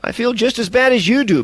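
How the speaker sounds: weak sheepish answer; lame apology. A narrator's reading. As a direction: apologize feebly, embarrassed. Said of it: "Bailey answered lamely".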